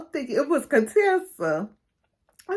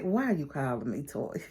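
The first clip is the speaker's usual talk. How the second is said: groggy husband; 8 semitones lower, 11 dB quieter than usual. Retold or imitated. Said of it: "he's just like".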